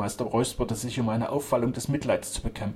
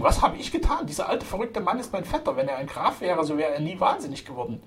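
high-pitched outraged protest; feigned indignation; 7 semitones higher than usual. A narrator's reading. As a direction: protest as the wronged innocent. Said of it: "mit gespielter Entrüstung".

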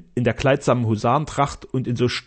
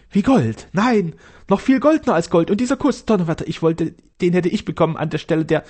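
higher, louder gushing, rushing at the end; rapturous; 6 semitones higher, 3 dB louder than usual. Acted. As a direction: get carried away by your own raving. Castellan raves on.

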